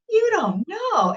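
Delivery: high, slow, drawn-out disbelief; incredulous high-pitched repeat of what was heard. Retold or imitated. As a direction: echo it back in stunned disbelief. Retold incredulous reaction to teacher.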